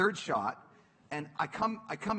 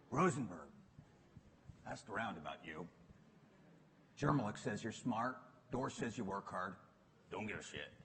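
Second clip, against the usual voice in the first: a hint of a gruff coach, lower; lightly voiced blunt boss, pitched down; Bill Campbell; 7 semitones lower, 4 dB quieter than usual. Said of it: "This guy comes in".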